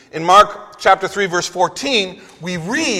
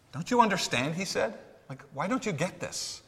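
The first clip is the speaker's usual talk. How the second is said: much softer, disappointed rebuke; chastising; 14 dB quieter than usual. Retold or imitated. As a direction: scold them in a pained near-murmur. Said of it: "You can hear them chastise".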